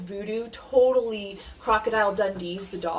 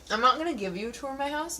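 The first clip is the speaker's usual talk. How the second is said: normal talking voice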